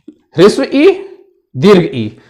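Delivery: ordinary delivery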